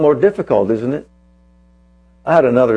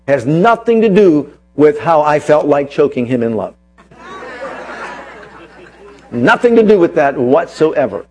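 raised, emphatic sermon voice, punching key words; preaching; 3 semitones higher, 3 dB louder than usual. Acted. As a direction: drive the point home to the congregation. Preacher builds his point.

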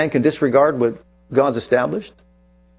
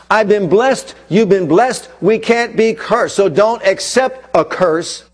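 full-force preaching; 5 semitones higher, slower than usual. Acted. Preacher rousing his congregation against curses, full-force preaching. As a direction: preach it hard to the back row.

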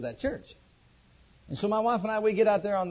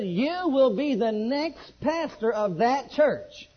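slow, louder, higher solemn pronouncement; voice of God; 6 semitones higher, 6 dB louder, much slower than usual. Retold or imitated. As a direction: announce it slowly like a voice from heaven. The voice in the back seat.

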